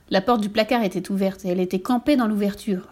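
plain reading voice

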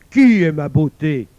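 loud demon roar; growling demon. A narrator's reading. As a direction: bellow the question as the demon. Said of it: "gronda le démon". (démon qui gronde, très fort)

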